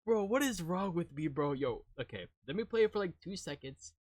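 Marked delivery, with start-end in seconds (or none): none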